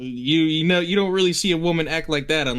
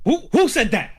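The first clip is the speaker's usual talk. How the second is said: stern father; 12 semitones higher, 5 dB louder than usual. Acted. Father voice, comic scene.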